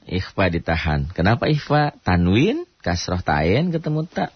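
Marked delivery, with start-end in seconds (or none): none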